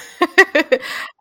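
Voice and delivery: high, loud giggle; high-pitched happy laughing; delighted laugh. Real, not acted. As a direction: burst into a real laugh. Laughs after question about past job.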